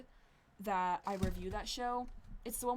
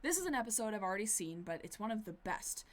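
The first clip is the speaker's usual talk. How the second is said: ordinary talk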